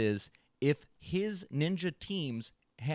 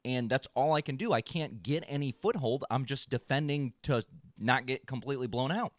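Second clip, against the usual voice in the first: ordinary talk, nothing marked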